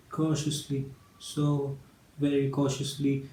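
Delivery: soft, careful and halting; hushed caution. Acted. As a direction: drop the volume as if creeping up on a sleeper. Narrator re-enacts creeping caution.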